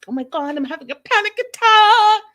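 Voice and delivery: high, loud panicked wail; hammed-up freak-out, stretching the last word into a shriek; mock panic. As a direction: imitate someone shrieking in a panic. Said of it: "she starts panicking".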